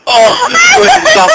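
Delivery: high squeal of laughter; laughing shout. Real, not acted. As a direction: shriek through the giggles. Can't stop laughing.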